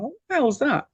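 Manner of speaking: startled thought. Retold or imitated. Retold startled thought at bright light.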